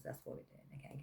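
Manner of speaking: quick whispered aside; whispering. Real, not acted. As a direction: drop to a whisper. Aside to viewers, unvoiced.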